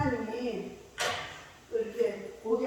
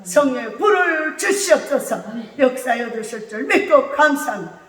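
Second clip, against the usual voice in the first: 점점 고조되는 외치는 기도; 목소리를 높여 간구하듯; 열정적인 기도; 3 semitones lower, 5 dB louder than usual. Real, not acted. Prayer rising to fervent shout. (점점 뜨거워지는 기도)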